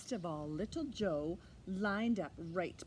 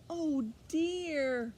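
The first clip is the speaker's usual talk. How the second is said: "Oh dear!" cried out higher in dismay; dismayed exclamation; 6 semitones higher, 4 dB louder than usual. A narrator's reading. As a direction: exclaim "Oh dear!" as the ducklings drop. Ducklings fall into manhole.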